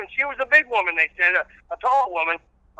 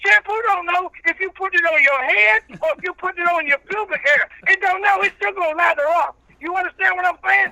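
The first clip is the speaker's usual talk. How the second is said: country preacher character; 9 semitones higher than usual. Acted. Old reverend preaching patience to the radio host, in full preacher voice. Reverend rants about dog toys.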